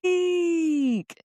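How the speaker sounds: high squeaked eek; a tiny horrified squeak; mock shriek. Squeaking eek as the bossy referral lands. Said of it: "And I'm like".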